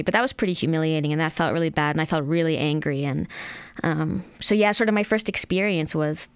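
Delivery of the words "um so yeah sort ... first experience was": usual speaking voice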